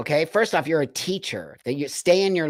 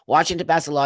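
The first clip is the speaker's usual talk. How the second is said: normal talking voice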